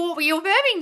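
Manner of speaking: ordinary talk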